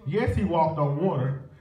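ordinary talk

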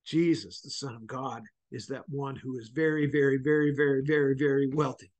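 low, soft and sincere, drawing them close; tender hush. Real, not acted. Preacher to his congregation about Christ's love, tender and hushed.

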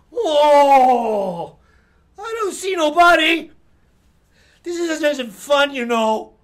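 put-on high, loud whining kid; whiny Fat Boy. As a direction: whine like a sulky boy who must be it. Fat Boy searching, shouting.

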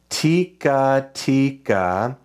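"Tika tika" drawn out, one syllable at a time; slow, spaced-out rhythm syllables. Shown as a demonstration. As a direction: stretch each syllable as if writing it. Sounding out while drawing each note.

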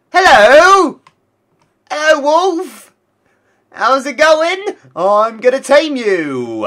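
slow, high, hammed-up greeting to a wolf; silly greeting voice. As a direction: coo at the wolf like a cartoon hunter.

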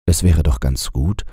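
plain reading voice

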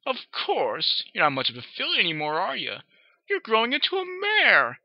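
high, patronizing sing-song; condescending mother. Acted. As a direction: praise her while putting her down.

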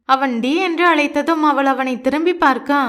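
plain reading voice

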